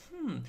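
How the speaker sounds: a high, rising curious hmm. Shown as a demonstration. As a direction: hum as if truly intrigued. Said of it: "you wanna be curious".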